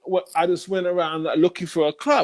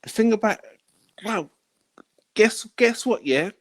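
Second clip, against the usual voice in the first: higher, lively voice of the buyer; hint of an excited customer; 5 semitones higher than usual. Buyer gushing feedback to the seller, lightly higher voice. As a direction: gush about the drugs. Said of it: "He says".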